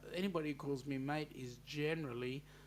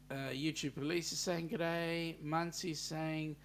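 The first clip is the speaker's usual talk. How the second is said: ordinary talk, nothing marked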